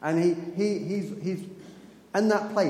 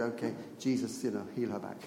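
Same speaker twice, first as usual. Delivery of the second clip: softer, lower, offhand prayer; quiet little prayer; 6 semitones lower, 7 dB quieter than usual. Retold or imitated. Sister praying casually for the lady's back, soft and low. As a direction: murmur it like a throwaway prayer.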